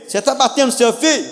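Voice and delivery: lightly voiced officer; mock stern policeman. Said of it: "a polícia vai lá".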